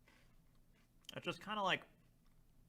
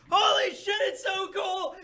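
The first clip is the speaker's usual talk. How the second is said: very high, loud fanboy yelling; excited shout; 12 dB louder, slower than usual. Retold or imitated. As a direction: scream it like a hyped fanboy. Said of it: "my discussion of that chapter would just be like".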